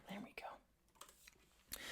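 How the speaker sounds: whispered aside. Real, not acted. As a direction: breathe it quietly.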